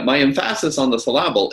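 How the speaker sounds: em-FASS-is on the suh-LAB-ul; emphasis and syllable jokingly stressed on their middle syllables; stress on wrong syllables. Shown as a demonstration. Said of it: "On the right syllable".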